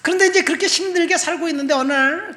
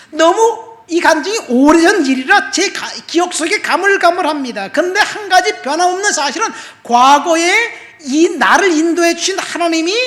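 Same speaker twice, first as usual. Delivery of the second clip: louder, higher preaching, stretched out and urgent; preaching at full force; 7 semitones higher, 10 dB louder than usual. Acted. Preacher stirring the congregation, loud and fervent. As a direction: drive the testimony home to the congregation.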